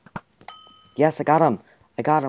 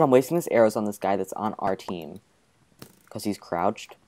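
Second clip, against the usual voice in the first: ordinary talk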